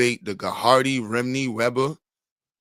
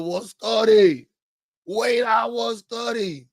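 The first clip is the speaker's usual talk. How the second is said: mock surprise; 8 semitones higher, slower than usual. Real, not acted. Mock surprise at own age.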